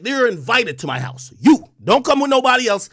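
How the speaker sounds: usual speaking voice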